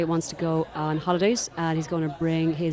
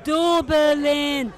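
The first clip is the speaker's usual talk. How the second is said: higher, louder, flat Dublin reply; Dublin accent; 9 semitones higher, 6 dB louder than usual. Retold or imitated. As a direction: answer with a plain Dublin "Dublin.". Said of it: "so I'm gonna use a Dublin accent".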